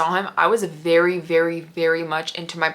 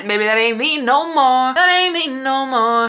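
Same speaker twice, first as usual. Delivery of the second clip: sassy; 5 semitones higher, 4 dB louder than usual. Pretend. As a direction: sing it out like a diva brushing him off. Sassy declaration she has changed.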